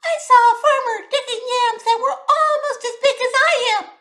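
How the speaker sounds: very high, whiny tiny mosquito. A narrator's reading. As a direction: squeak it out like a buzzing insect. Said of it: "The mosquito said".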